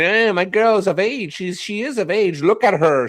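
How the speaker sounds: mocking a man who insists his girl is of age, high and whiny; mock whiny Ron. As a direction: whine it like a pleading creep. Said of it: "crying about your stupid fucking".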